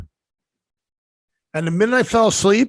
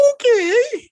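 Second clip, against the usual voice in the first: very high, drawn-out incredulous okay; stunned okay; 4 dB louder than usual. Retold or imitated. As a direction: voice the sheer disbelief. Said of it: "I was like".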